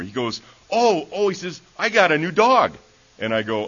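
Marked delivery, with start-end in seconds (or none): 0.7-2.8 put-on high, loud, eager boy bursting with news; excited child. A boy tells his teacher about his new dog, excited and loud. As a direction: blurt it out like a thrilled kid.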